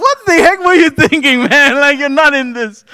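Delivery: high, loud, laughing exasperation; cracking up while scolding a teammate; laughing disbelief. Retold or imitated.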